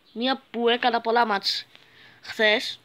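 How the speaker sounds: normal talking voice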